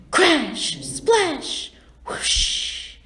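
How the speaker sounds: punchy, high splash into a breath-only whoosh; acted sound effects. A narrator's reading. Bridge falls into the river, vivid sound-effect words. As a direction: act out each noise word like what it names.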